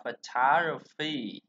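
slow "photography" as fuh-TAH-gruh-fee; second-syllable stress. Shown as a demonstration. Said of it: "tương tự như trên đây thôi".

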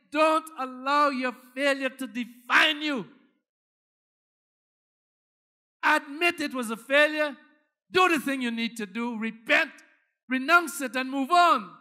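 loud fervent preaching exhortation; rally the congregation hard; preaching at full force. Real, not acted.

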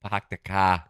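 imitated Boston dropped R's; Boston accent. Shown as a demonstration. Echoing the co-host's example, mock Boston accent. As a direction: do a quick Bostonian. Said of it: "Have you heard a Boston accent".

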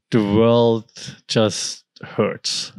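drawn out very slowly, each word dragged; slow heavy weight. Shown as a demonstration. Explaining Weltschmerz to listeners, slow and heavy. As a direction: let "the world just hurts" sink in slowly, word by word. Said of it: "They call it Weltschmerz".

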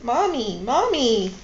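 loud, swooping mock child's call; upset cry for mother, acted in narration. A narrator's reading. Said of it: "He started to cry and call out".

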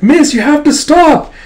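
very high, loud frantic cry; desperate shouted plea. Acted. Kippa to miss amid the clash, frantic high shout. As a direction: beg at full voice for it to stop.

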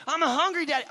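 high, loud mock kid whine; hangry child. Hungry son complaining to Daddy, hangry outburst. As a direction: imitate a four-year-old hollering for food. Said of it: "he's hangry at this point".

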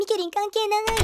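slow, stretched-out disclaimer; drawn-out protest. Acted. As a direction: draw out the denial of any involvement. Girlfriend disowns the landlord's complaint.

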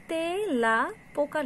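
তেলা পোকা slowly by syllable, "te-la po-ka"; spelling pronunciation. Shown as a demonstration. Teacher reading out the written form, slow closed e in তেলা. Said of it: "লেখার সময় কিন্তু আমরা".

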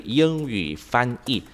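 each syllable stretched out slowly; slow dictation. Shown as a demonstration. Teacher finishing the sentence the student types, slowly. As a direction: dictate the last words very slowly. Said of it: "giờ em gõ câu này vào đi".

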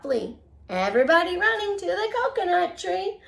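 lively storybook read-aloud; reading to children. Acted. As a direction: read it like a bedtime picture book. Reads picture book to children.

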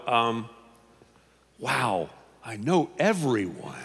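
ordinary talk

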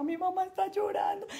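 put-on high, tearful little boy's wail; crying little brother. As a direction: sound like a small boy upset that mom is crying. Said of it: "mi hermano menor lloraba".

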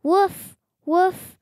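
high, bright little yips of a puppy; puppy woof. Acted. Reading a picture book aloud, puppy Biscuit barks with high yips. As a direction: yap it like a happy puppy.

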